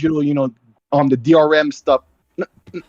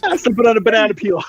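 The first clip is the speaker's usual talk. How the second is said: laughing; 7 semitones higher, 3 dB louder than usual. Real, not acted. Joking about the voice.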